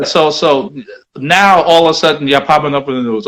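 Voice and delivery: ordinary delivery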